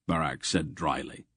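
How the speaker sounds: usual speaking voice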